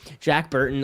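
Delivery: ordinary talk, nothing marked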